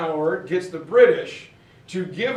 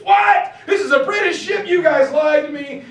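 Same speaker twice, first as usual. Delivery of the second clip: loud, high-pitched mock outrage; angry outburst; 9 semitones higher, 7 dB louder than usual. French general discovering he was tricked aboard a submarine, angry shouting. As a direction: imitate an indignant shout, fast and high. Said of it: "So he was all angry".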